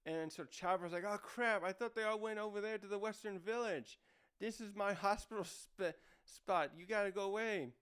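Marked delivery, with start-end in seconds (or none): 1.1-7.7 higher-pitched flustered protest, lightly acted; half-playful panicked complaint; mock alarm. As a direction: imitate someone dismayed at intruders. Chopper's alarm at intruders acted out.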